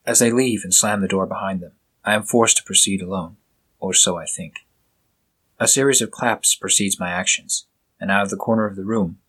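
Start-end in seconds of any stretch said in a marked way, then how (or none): none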